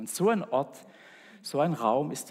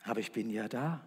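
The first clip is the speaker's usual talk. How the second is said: lower, softer, tender; gentle; 4 semitones lower, 6 dB quieter, faster than usual. Acted. God answers the waiting man, soft and tender. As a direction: say it softly, calmly present.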